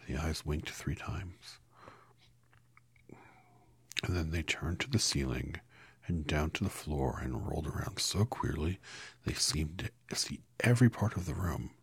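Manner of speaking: plain reading voice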